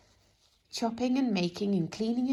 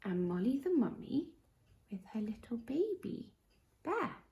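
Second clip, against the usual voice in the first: bedtime story intro; 5 semitones higher than usual. Acted. Storyteller opening a bedtime story, hushed. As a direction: settle the children in gently.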